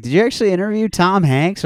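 high, loud incredulous fan voice; mock fan question. Podcast host mimics listeners asking about Tom Hanks, high and incredulous. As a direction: squeal it like a starstruck fan. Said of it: "They're like".

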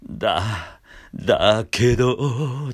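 slow creaky scoop up from below; vocal fry rising into だけど; edge voice. Shown as a demonstration. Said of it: "下からしゃくって".

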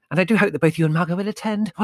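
very high, loud, rapid gush; breathless zealous lady; gushing spiritualist woman. Acted. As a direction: rattle it off in one breath, ending in a huge gasp.

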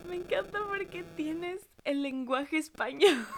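slow, high, laughing quote; mock the line through laughter; giggling retelling. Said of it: "Y después dice".

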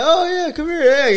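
high-pitched, hammed-up beckoning; mock hearty welcome. Imitating family welcoming a guest to the party, excited high voice. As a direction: wave them in with an exaggerated high call.

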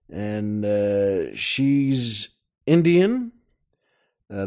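ordinary delivery

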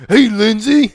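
loud, high, starstruck hello; eager greeting. Retold or imitated. Fan meeting Lindsey Vonn, loud, high and overeager. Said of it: "that's how I introduced myself".

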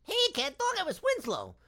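high squeaky cartoon cat voice; cartoon character voice. Voice actor showing off his cartoon character, high squeaky voice. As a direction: pop into the Winslow cartoon voice.

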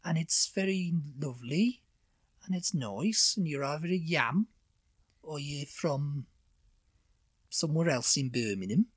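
Birmingham accent. Shown as a demonstration. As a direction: talk like someone from Birmingham. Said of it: "let's hear you do a Birmingham accent".

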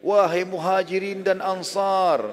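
loud, high, drawn-out proclamation; imitated shout. Prophet summons the crowd from a high rock, imitated shout. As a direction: call out slowly to a whole crowd. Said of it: "berdiri di atas batu yang tinggi teriak".

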